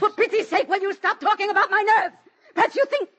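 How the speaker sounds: very high, loud, near-shrieking anger; hysterical outburst. Acted. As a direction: lose control at the doctor. Shouting at doctor.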